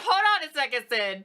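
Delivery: loud, high call to wait; mock shout. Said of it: "Be like".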